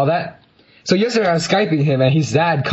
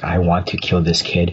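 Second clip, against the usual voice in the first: low, tense retold threat; pitched-down mock menace; suppressed rage; 8 semitones lower than usual. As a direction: seethe it under control, mildly. Retold suppressed rage, fists clenched.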